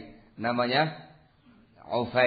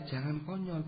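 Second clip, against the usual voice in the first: softer, falling warning; quiet warning; 3 semitones higher, 9 dB quieter than usual. Real, not acted. (peringatan pelan dan lirih)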